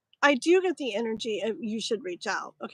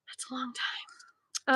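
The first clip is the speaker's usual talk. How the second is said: whispered aside; 7 dB quieter, slower than usual. Real, not acted. Tired streamer whispering to herself about the long stream. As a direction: mutter it to yourself under your breath. Aside after six hours live.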